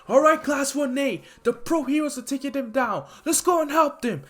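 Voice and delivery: rally the class to the fight; rallying call. Retold or imitated. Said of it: "he's like".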